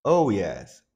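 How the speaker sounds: ordinary talk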